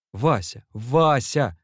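shouting through tears; tearful shout. A narrator's reading. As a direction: cry out the name, building in alarm. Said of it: "заливаясь слезами".

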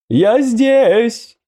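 high, loud, echoing otherworldly voice; resonant booming voice. Acted. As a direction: call out like a being from beyond. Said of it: "раздался звучный гулкий голос".

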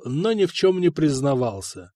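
usual speaking voice

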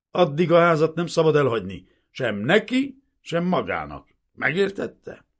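loud, high contemptuous dressing-down; hostile, hectoring officer; bullying orders. A narrator's reading. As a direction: lay down the law aggressively. Lieutenant angrily lays down orders.

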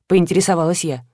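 usual speaking voice

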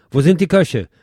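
loud barked question at the staff; an officer bellowing for the cooks; demanding officer. Retold or imitated. Reviewer voicing the Falangist officer, loud demand. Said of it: "Eine Kostprobe aus einem der Dialoge".

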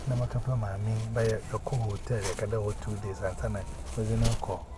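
usual speaking voice

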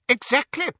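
high, triumphant pedant; a know-it-all's "exactly"; pedantic. A narrator's reading. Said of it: "his forefinger raised pedantically".

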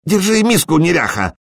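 innkeeper's scolding cry. A narrator's reading. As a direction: call out crossly to cover the secret exchange. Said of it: "крикнул корчмарь". (высокий сердитый окрик корчмаря)

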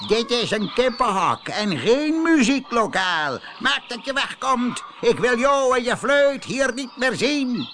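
high, loud, furious farmer; angry shout. Acted. Farmer chases the flute-playing hen from the coop, shouting. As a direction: throw her out with a shout. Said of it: "riep tegen Doortje Dapper".

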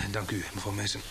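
soft, feeble reply from a hurt man; weak and dazed. Acted. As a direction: speak as if just coming round after the accident. Dazed after being hit by a car.

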